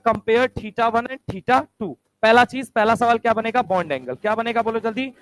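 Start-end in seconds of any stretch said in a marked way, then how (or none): 0.1-1.9 very slow, word by word, like dictating a question; slow dictation. Shown as a demonstration. Teacher dictating an exam question to the class, very slowly. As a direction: spell the question out slowly for students to copy. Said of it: "बोलेगा".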